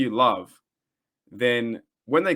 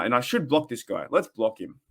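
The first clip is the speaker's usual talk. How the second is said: ordinary talk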